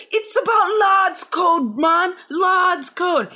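high, shouted Geordie rant; Newcastle accent. Retold or imitated. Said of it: "my attempt at a, a Newcastle accent".

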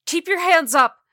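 high, very loud command; yelling. Acted. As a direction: yell it at a suspect. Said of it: "Ruthie yelled".

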